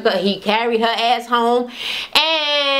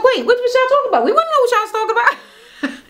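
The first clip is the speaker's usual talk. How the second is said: high, rapid mock-urgent questioning; eager nosiness; 12 semitones higher, much faster than usual. Tara demands to know what they were discussing, eager nosiness. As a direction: butt in with pitch way up.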